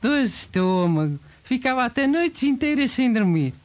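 earnest commercial testimonial voice. Acted. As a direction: sell it like a TV ad. Fake testimonial ad read.

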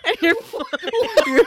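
shrieking laughter through the words; laughing. Real, not acted. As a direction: talk while cracking up. Laughing hard while urging.

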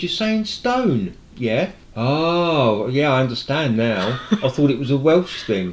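high-pitched retold understanding; dawning realisation. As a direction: act out the builder finally getting it. Builder finally understands, retold.

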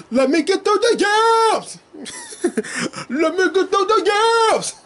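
high, stretched cheering call; excited cheer. Pretend. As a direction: hype her up like a fan in the stands. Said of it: "repeats the cheer".

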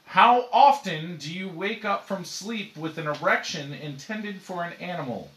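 slow, loud announcer delivery; dramatic host read. Acted. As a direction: read it like a serious show host. Mock dramatic question read.

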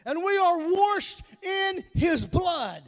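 impassioned shouted sermon, driving phrase by phrase; rousing pulpit cry; full-force preaching. Real, not acted. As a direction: preach it hard, leaning on each key word.

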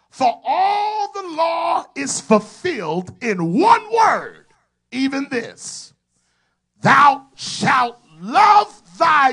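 slow, loud, high-pitched scripture reading that swells and fades; preaching at full force. Acted. Preacher reading Galatians to his congregation, full preaching force. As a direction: proclaim each phrase like thunder from the pulpit.

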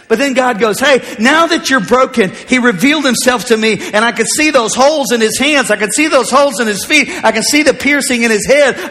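high, driving revival delivery; full-force preaching. Real, not acted. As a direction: build to a loud, fervent climax.

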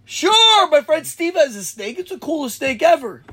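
high, eager retold yes; mock-enthusiastic, pitched well up; eager agreement. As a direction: jump at it with naive delight. Retells own naive eager yes.

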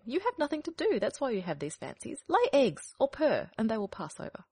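high, shrill-toned bossy hen. Acted. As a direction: lecture like a fussy hen. Hen lectures.

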